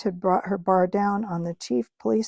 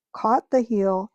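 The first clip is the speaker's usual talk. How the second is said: ordinary delivery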